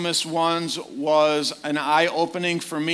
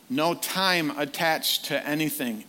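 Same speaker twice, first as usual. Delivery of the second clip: ordinary delivery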